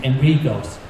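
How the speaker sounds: ordinary talk, nothing marked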